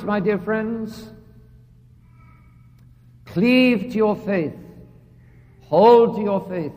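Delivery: slow, earnest appeal; slow earnest plea. Real, not acted. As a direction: draw out "my dear friends" slowly, pleading. Slow pleading to congregation.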